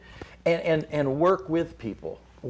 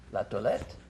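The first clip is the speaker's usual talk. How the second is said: French; 4 semitones lower, 6 dB quieter than usual. Retold or imitated. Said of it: "she says".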